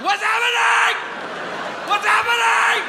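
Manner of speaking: high, wailing shout; hammed-up sobbing teacher, very high; mock crying. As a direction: wail it like a teacher breaking down. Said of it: "Why are you crying so much right now".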